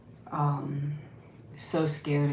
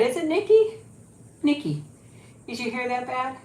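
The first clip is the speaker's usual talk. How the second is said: pitched up, teasing the dog; high pet talk; 7 semitones higher than usual. Real, not acted. Owner teasing Nikki about her hair in pet talk. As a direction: ask her sweetly, up high.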